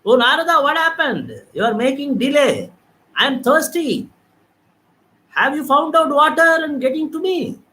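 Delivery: a touch of a faraway shout; mock distant call. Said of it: "Far away, he was shouting".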